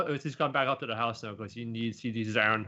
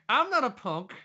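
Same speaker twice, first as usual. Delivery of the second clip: squeaky indignant protest; 13 semitones higher than usual. Acted. Repeated, higher, other timbre.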